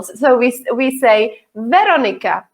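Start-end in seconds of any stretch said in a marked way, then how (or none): 1.5-2.5 "Veronica" with the stress on "Ve"; first-syllable stress. Shown as a demonstration. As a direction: hit the first syllable of "Veronica", Czech style. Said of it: "We have the stress on the first syllable".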